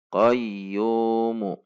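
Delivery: "qayyumu" recited slowly, "yuu" held two beats, ending on short mu; short connected reading. Shown as a demonstration. Teacher models continuing onward, two-count vowel. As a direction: keep the long vowel of "qayyumu" moderate, then a brief "mu". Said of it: "maka ini dibaca dua harokat".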